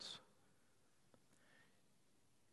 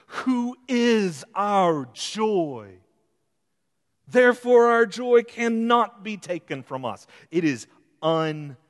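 building sermon; 4 semitones higher, 8 dB louder than usual. Acted. Preacher building to a climax.

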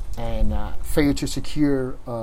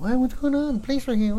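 higher-pitched imitation of curious onlookers; lightly voiced busybody, pitched up; nosy neighbors; 11 semitones higher than usual. Neighbors asking about police at the scene, nosy. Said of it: "people get nosy".

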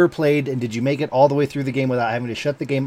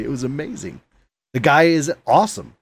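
ordinary talk, nothing marked

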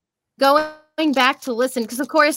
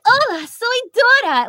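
high-pitched children's cartoon greeting; Dora; 13 semitones higher than usual. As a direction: pipe it up high as a cartoon girl. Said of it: "and then Dora".

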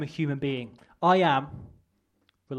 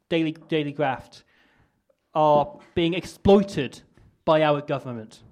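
louder, rousing stump speech to the crowd; rally speech; 2 semitones higher, 7 dB louder than usual. Acted. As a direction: rouse the crowd like a campaigner.

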